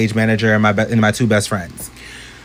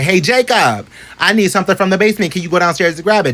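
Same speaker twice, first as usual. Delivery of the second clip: stage manager voice; 6 semitones higher than usual. Retold or imitated. Stage manager asks Jacob.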